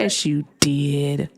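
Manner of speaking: slow, stretched-out comeback; match the denial word for stretched word; drawn-out contradiction. Real, not acted. Host answers the drawn-out denial.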